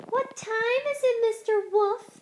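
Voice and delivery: high, sweet chicken voices. A narrator's reading. Chickens sweetly asking the wolf. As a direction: sing it out sweetly like little hens. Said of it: "they asked, smiling sweetly".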